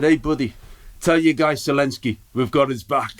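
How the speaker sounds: playing a burly American guy in the audience, American accent. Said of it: "could not have looked more American".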